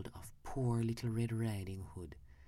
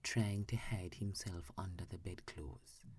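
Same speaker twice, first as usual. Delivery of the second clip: plain reading voice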